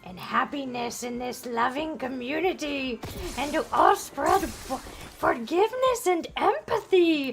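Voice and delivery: drawn-out, rising villain gloat; mock scheming villain. Villain boasting of a plan to unite everybody, mock scheming. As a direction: gloat slowly like a cartoon mastermind.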